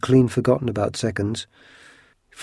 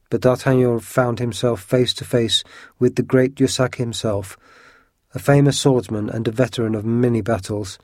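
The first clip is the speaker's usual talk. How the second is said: plain reading voice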